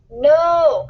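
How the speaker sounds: Henry's despairing shout of no; cry of anguish. A narrator's reading. Henry hearing his family was sold, anguished cry. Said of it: "cried Henry".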